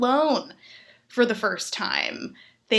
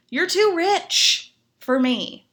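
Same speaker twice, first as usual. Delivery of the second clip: slow, higher mock verdict; mock judgment; 6 semitones higher, 4 dB louder, much slower than usual. To a rich oven user, a slow mock verdict. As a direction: drag it out like a judgment. Punchline judging rich people.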